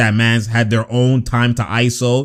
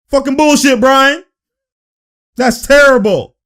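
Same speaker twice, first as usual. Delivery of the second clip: raised, high mock outburst; imitating furious player; 12 semitones higher, 5 dB louder than usual. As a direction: yell like a player fed up with the ref. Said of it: "He's like".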